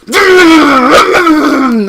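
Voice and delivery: high, loud crazed laugh; wild slasher laugh; maniac Leatherface cackle. Retold or imitated. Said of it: "Leatherface jumps out or goes".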